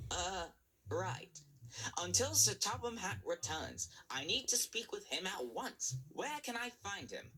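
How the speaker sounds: a hint of a hesitant engine; lightly voiced engine Duck. A narrator's reading.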